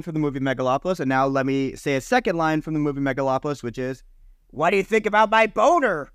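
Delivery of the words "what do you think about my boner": quoting Jon Voight. Retold or imitated. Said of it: "That is said by Jon Voight".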